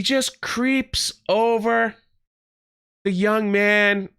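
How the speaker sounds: drawn-out, creeping slow delivery; slow motion. Retold or imitated. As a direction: stretch the words out as if in slow motion. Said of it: "walk in super slow motion".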